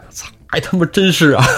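comic outburst of dawning realisation; acted shock. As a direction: curse in amazed recognition, hammed-up. Storyteller acts hero's shocked recognition.

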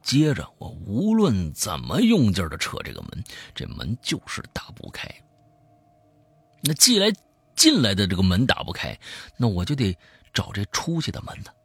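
lively storyteller swinging between hushed and emphatic; spoken horror-story narration; dramatic storytelling. A narrator's reading. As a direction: tell a creepy tale with punched key words. Tells a horror story aloud.

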